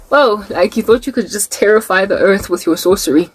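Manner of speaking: normal talking voice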